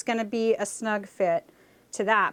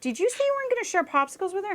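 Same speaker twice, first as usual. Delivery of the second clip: mom voiced; 9 semitones higher, much faster than usual. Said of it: "And my mom's like".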